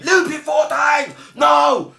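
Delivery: high, loud retold scream. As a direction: stretch it into a frantic demanding yell. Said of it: "Started screaming".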